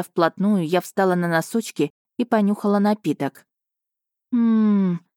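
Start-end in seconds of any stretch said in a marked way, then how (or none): 4.3-5.0 higher, savouring "mmm"; a long hum of delight; blissful moan. A narrator's reading. Heroine smells hot chocolate, blissful moan. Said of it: "блаженно простонала я".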